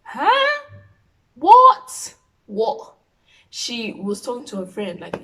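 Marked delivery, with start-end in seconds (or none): 0.0-3.0 high, loud squeal of disbelief; mock shock. Vlogger overhearing her mom on the phone, mock shock.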